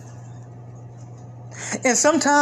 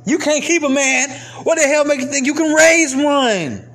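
raised pitch, forceful rhetorical question; emphatic challenge; 6 semitones higher, 3 dB louder than usual. Real, not acted. Man repeats his challenge to women, emphatic. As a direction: drive the challenge home, voice pitched up.

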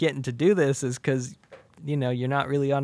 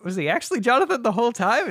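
confused; 9 semitones higher, faster than usual. Retold or imitated. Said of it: "I'm confused".

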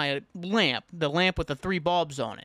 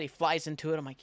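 usual speaking voice

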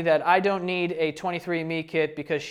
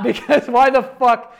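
laughing; 5 semitones higher, 7 dB louder, slower than usual. Real, not acted. Laughing at mother's odd claim.

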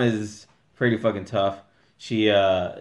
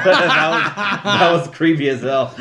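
laughing; 5 semitones higher, 5 dB louder, slower than usual. Real, not acted. Laughing at the impression.

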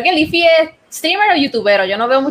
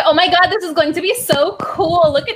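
gushing fan voice; 4 semitones higher than usual. Retold or imitated. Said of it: "Como que".

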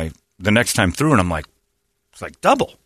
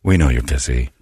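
mock pleasant hotline voice; soothing phone rep; 11 semitones lower than usual. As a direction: imitate a rep soothing an angry caller. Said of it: "It's always a real pleasant voice".

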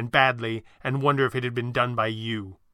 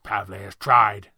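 lower, louder, slow spat words; 5 semitones lower, 5 dB louder, slower than usual. Acted. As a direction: spit it out in contempt. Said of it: "Thatcher spat".

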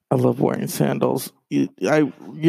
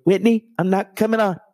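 much higher, hammed-up diva voice; sassy diva refusal; 9 semitones higher than usual. [name] refusing Wendy's show.

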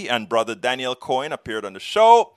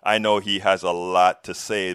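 ordinary talk, nothing marked

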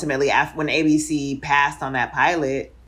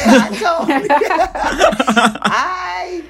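high laughing words; laughing at herself, worn out; 9 semitones higher, 6 dB louder than usual. Real, not acted. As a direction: laugh through the line, exhausted. Laughing while admitting tiredness.

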